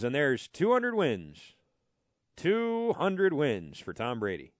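slow, deflated play-by-play call; flat announcer voice. Retold or imitated. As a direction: call it like you lost a bet. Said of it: "Didn't even raise his voice".

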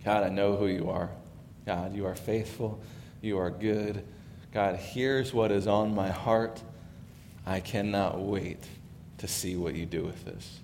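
hushed, intimate voice of David praying; imagined prayer. Retold or imitated. Pastor voicing David's hushed prayer to God. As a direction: pray it quietly as David. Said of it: "just imagine the prayer of David here".

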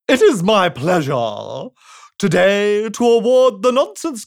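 slow, loud royal proclamation; pompous king. Acted. The king addressing the crowd, slow and pompous. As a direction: boom it out grandly and slowly. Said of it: "continued the king".

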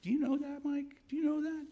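very high, loud southern lady voice; imitating Alabama mentor. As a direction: mimic an old mentor from Alabama quizzing you. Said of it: "I can't do her accent, but".

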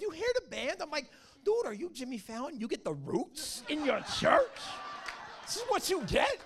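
mock amazement, pitch climbing high on the last question; amazed disbelief. Preacher marvelling at the church band, high amazed disbelief. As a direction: gasp it out like you can't believe the band.

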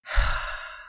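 voiceless exhaled sigh; heavy sigh. A narrator's reading. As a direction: let out a big resigned breath. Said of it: "Pearson sighed".

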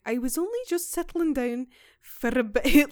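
put-on quoting the woman in a shaky Irish accent. Podcaster quoting the old Irish housekeeper, light Irish accent. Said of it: "I struggle with this accent because it sounds Scottish to me".

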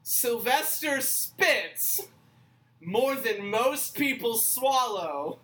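slow, drawn-out, deliberate quote; emphatic quoting. Retold or imitated. Quoting a friend's remark back for posterity, slow and emphatic. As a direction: spell it out with gleeful weight. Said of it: "and I quote".

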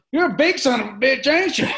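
high, loud mock good-old-boy; loud roofer impression. Imitating a roofer sizing up a huge stranger, high and loud. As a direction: holler it like a nosy construction guy.

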